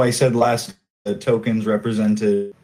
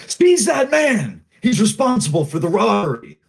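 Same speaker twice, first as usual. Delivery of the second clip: shouting out; 11 semitones higher, 3 dB louder than usual. Acted. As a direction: shout an accusation out over a crowd. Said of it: "he shouts out".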